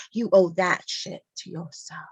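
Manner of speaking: normal talking voice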